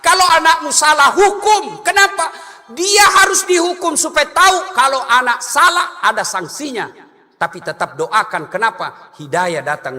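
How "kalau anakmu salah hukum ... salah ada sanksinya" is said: higher and louder, hammering the point; forceful preaching. Real, not acted. Preacher urges parents loudly to punish a child's wrongdoing. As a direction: drive the lesson home with force.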